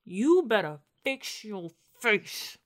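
slow, deliberate warning from a mother; measured motherly scolding; stern mama. Acted. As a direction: draw out the threat like a fed-up mama. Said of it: "My mama would've pinched me".

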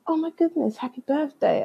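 high, soft retold gushing; agent's congratulations. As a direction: coo it, pitched right up. Voices agent's birthday congratulations.

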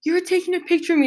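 high, quick mock-distressed accusation; upset, near-tears accusation. Retelling the passenger pointing at her, high upset accusation. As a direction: imitate someone accusing in agitation, close to crying. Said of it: "he's starting to cry".

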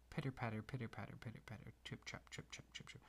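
pitter-patter fading down to a whisper; fading footsteps. Acted. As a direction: let the steps fade away into a whisper. Paw steps fading over bridge.

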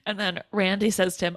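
normal talking voice

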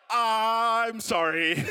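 very drawn-out apology through laughter; laughing apology. Acted. Husband apologises to pregnant wife, laughing. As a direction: stretch the sorry out between giggles.

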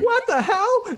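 very high, loud squeal of disbelief; mock shocked outburst. Listeners hearing the chip ad, squealing in shock. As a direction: shriek it in fake shock. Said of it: "And they'd be like".

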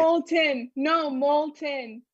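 MOLTEN said slowly and firmly; "molten" pronounced slowly and distinctly; emphatic correction. Shown as a demonstration. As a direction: stretch out "molten" to correct someone. Correcting her friend's word molten.

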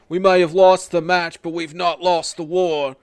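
loud defiant declaration; defiant promo. Retold or imitated. Host quoting Barrett's promo, loud and defiant. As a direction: shout it like a wrestler on the mic. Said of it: "He's like".

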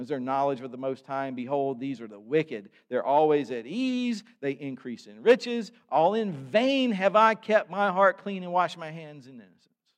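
loud, declaiming psalm reading from the pulpit; preached scripture reading. Acted. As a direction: proclaim the psalm to the congregation. Reads psalm aloud, preaching.

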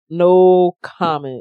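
slow, loud, drawn-out refusal; emphatic refusal. Real, not acted. Refusing to answer the fighting back charge, slow emphatic.

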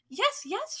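high, insistent storybook cry; shout. Acted. First witch convincing the doubting others, shouting high. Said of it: "shouted the first witch".

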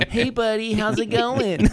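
high, sugary mom voice; mocking imitation. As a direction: gush over her son on the phone. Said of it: "Erica does this thing".

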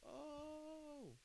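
very high, slow amazed voice; mock crowd amazement. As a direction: stretch it out like a gasping crowd. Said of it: "everyone was like".

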